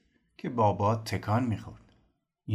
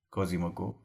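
plain reading voice

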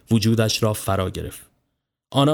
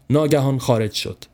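ordinary delivery

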